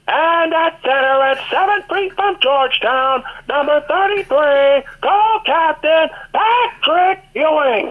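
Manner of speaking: high, stretched arena call building to "Patrick Ewing"; slow, soaring build on "co-captain Patrick Ewing"; Mike Walczewski announcer. Retold or imitated. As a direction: draw out the name like a hyped PA announcer. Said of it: "the current P A voice, Mike Walczewski, would say".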